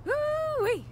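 a high thrilled cheer; delighted whoop. Acted. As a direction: squeal with delight at a find. Delighted at found scrap.

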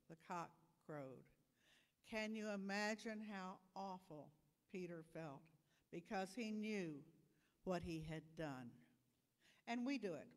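ordinary talk, nothing marked

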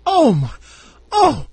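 high mock-stunned child; high, drawn-in little 'oh' of astonishment; shocked kid. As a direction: imitate a kid's dawning shock, pitch climbing. Kid's reaction to the revelation.

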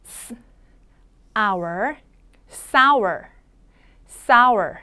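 "sour" built up sound by sound: s, then our, then the whole word twice; 소리를 나눠 보여주는 sour. Shown as a demonstration. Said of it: "한 번 더".

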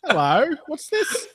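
put-on very high, slow British accent on "Hello, what's this?"; hammed-up British bobby asking "Hello, what's this?"; a mock Brit who has slipped out of the Aussie voice. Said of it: "That's becoming British".

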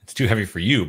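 ordinary talk, nothing marked

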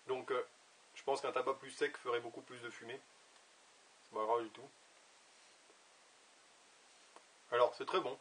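talking around pipe stem; pipe in mouth. Real, not acted. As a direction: lips gripping pipe. Seen 1: Pipe stem held at lip corner while talking, briefly lowered near 0.6-0.9s.

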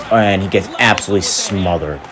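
usual speaking voice

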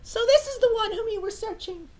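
high, light princess voice; Nintendo princess voice. Acted. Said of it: "every Nintendo Princess voice".

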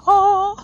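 high, loud imitated "oh"; mimicked death cry. Gamer mimicking how she died, mock death cry. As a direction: cry out like the dying enemy. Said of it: "It's like".

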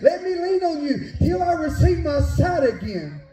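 high, urgent sermon delivery; full-force preaching. Real, not acted. Preacher urging the church to regain its vision, full-force preaching. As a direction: preach it with rising fervor, pitched well above normal talk.

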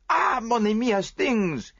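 high, loud joyful greeting; delighted cry. Acted. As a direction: call out to him with delight. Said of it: "he cried".

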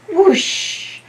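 high airy whoosh of wind; playful wind sound effect. Acted. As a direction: blow it like a gust. Wind blows off hat.

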